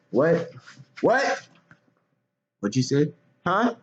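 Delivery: high, incredulous what, what; mock outrage. Feigning outrage at an imagined insult. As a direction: act shocked and offended.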